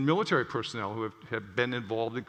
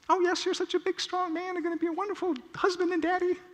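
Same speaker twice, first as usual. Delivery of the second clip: much higher, sweet adoring woman's voice; doting wife. Acted. Newlywed wife praising her future husband, high adoring voice. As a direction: gush over him like a smitten bride.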